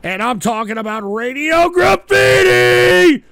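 big, slow, high hype yell of the segment name; announcer shout. Acted. Host announcing his call-in segment to viewers, in a loud drawn-out announcer shout. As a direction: belt it out like a radio host announcing the main event.

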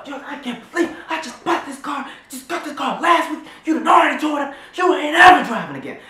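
high, loud mock rant building to a shout; furious dad losing it over the car; dad yelling. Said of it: "he was so mad".

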